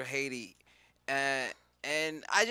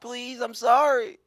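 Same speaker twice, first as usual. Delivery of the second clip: high, loud, drawn-out plea; begging mock apology; 8 semitones higher, 7 dB louder, slower than usual. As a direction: overdo the begging, slowly. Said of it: "He was just like".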